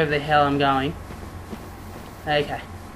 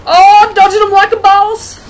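very high, loud whooping boast; gleeful bragging shout; 10 dB louder than usual. Real, not acted. Gamer dodging attacks, gleeful bragging shout. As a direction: crow it at full pitch.

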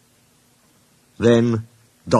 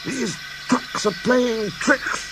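high, slow, soft puffed complaint; panting; 13 semitones higher, 5 dB quieter, slower than usual. A narrator's reading. James complaining about the trucks, panting. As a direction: drag it out as if short of breath. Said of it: "panted James".

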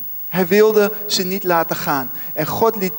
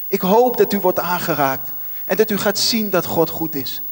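ordinary talk, nothing marked

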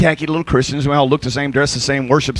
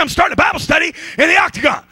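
excited quote; 11 semitones higher, faster than usual. Retold or imitated.

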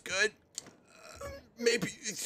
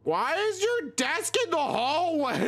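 high, incredulous shouted question; mock outraged shout; 7 semitones higher than usual. Streamer questioning a desk in the hallway, mock outraged shout. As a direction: cry out in exaggerated disbelief.

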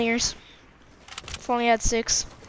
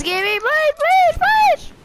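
rising, louder frantic pleading; desperate begging; 7 dB louder, slower than usual. Real, not acted. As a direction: beg harder and higher each time. Escalating begging for gun.

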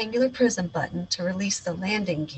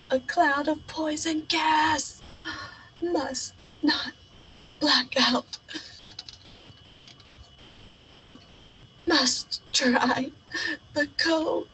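higher, strained and desperate; struggling in gas; 8 semitones higher, slower than usual. Acted. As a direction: fight to stay conscious. Choking in poison gas.

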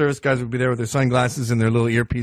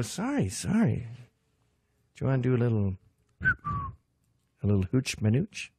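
sly offer; 7 dB quieter than usual. Retold or imitated. Intruder quietly offering the president coke, soft sly coax. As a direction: coax him quietly like a dealer. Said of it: "you go".

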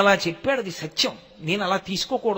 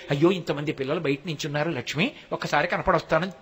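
tender aside; 5 semitones lower, 5 dB quieter than usual. Retold or imitated. Lord speaking tenderly to Lakshmi.

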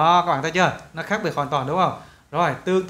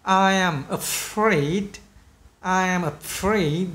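very slow, careful model sentence; pronunciation practice; much slower than usual. Shown as a demonstration. Teacher drilling sentences for students, slow careful modelling. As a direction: stretch each word for learners to copy. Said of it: "chúng ta sẽ luyện phát âm từng câu".

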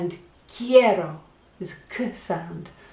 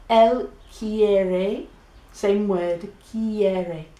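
normal talking voice

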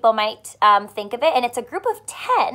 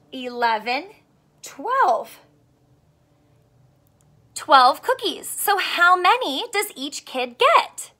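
put-on storybook read-aloud for kids; reading to children. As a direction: read it warmly to young listeners. Teacher reads picture book aloud.

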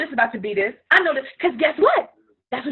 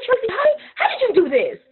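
amazed grateful client; 9 semitones higher, faster than usual. Retold or imitated.

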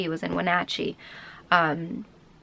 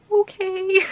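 high sheepish okay; giving in; 11 semitones higher than usual. Retold or imitated. Her giving in to boyfriend, high sheepish okay. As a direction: cave in with a high little okay. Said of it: "I'm like".

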